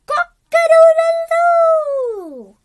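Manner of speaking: high, loud, slow cock-a-doodle-doo; rooster crow. Acted. Narrator voicing the rooster at dawn, loud crow.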